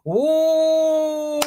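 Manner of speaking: long, high squealed "ooh"; excited ooh. Real, not acted. Streamer hearing of tonight's live show, excited squeal. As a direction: let out a sustained, eager ooh.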